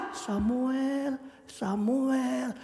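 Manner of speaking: hushed, drawn-out calling of a name; soft divine call. Acted. God calling sleeping Samuel, softly and slowly.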